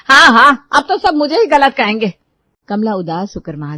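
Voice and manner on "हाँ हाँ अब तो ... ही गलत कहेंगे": very loud, high sarcastic cry; bitter outburst. Acted. Mother-in-law protesting that everyone blames her, loud bitter cry. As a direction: fling it out as you storm off.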